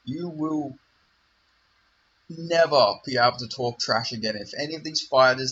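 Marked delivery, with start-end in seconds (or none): none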